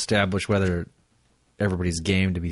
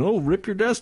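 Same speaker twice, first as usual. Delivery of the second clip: higher, lightly hammed-up neighbour; cheesy bad acting, pitched up; mock porn neighbour; 10 semitones higher than usual. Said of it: "the neighbors are like".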